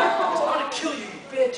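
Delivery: high, loud cartoon fury; hammed-up strangler's threat, pitched high; mock rage. Comic character about to choke his rival, mock-furious.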